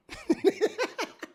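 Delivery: real laugh breaking out; burst of laughter. Real, not acted. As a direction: crack up high at what was read. Laughing at the texts.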